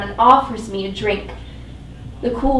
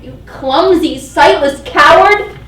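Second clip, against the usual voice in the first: higher, louder, slowing on coward; furious insult; 7 semitones higher, 10 dB louder than usual. Acted. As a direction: lash out at him in rage. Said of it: "the venom that I feel for him".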